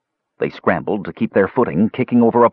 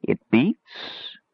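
put-on soft, high old woman's singsong echo; echoing old crone; 5 semitones higher, 5 dB quieter than usual. A narrator's reading. Old woman echoing the boy's chant, crone singsong. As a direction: echo it softly, pitch sliding up. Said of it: "echoed the old woman".

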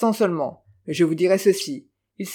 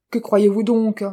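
louder, sharp indignant comeback; vehement retort; 4 semitones higher, 6 dB louder than usual. A narrator's reading. The count retorting to Lanyard, vehement and loud. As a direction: push back at him with heat. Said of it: "riposta le comte avec véhémence".

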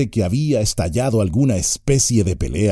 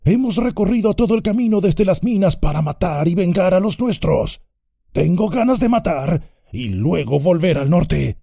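higher, fast vengeful orc; high, fast goblin; 11 semitones higher than usual. A narrator's reading. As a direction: jabber like a bloodthirsty goblin. Mine orc demands.

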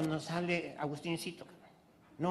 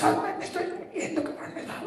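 high airy moan of pain; faked dying voice; 13 semitones higher than usual. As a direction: whine as if dying. Wife faking dying.

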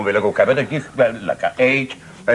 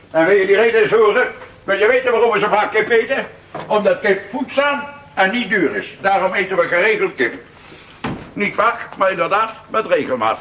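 raised pitch, sharp and indignant; irritated lecture; 6 semitones higher than usual. Acted. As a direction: lecture your sister, getting worked up. Father lecturing sister about money. (geïrriteerd, verheven toon)

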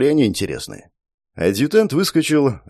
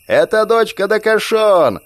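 put-on high, slow drunken yell; belligerent drunk; 11 semitones higher, 4 dB louder, slower than usual. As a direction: bellow it to the whole crowd. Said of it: "заорала предводительница".

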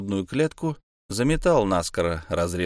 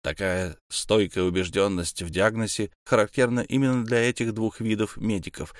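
plain reading voice

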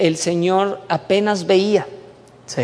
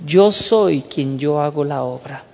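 voice of God; 5 semitones lower, 3 dB quieter, slower than usual. Retold or imitated. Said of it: "cuando Dios me habló y me dijo".

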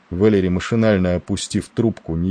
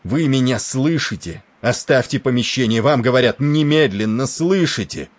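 raised-pitch, insistent commander; exasperated, higher-pitched command; urgent angry order; 6 semitones higher than usual. Acted. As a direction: demand obedience, pressing each phrase. Commander angrily orders deaf-eared sailor.

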